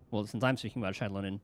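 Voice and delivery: ordinary talk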